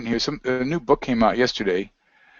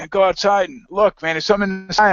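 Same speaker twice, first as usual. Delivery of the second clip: much higher, eager retold urging; urgent excited friend; 8 semitones higher than usual. Friend phoning about the sky, high and urgent. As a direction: imitate a friend insisting you go look now.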